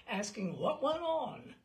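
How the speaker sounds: usual speaking voice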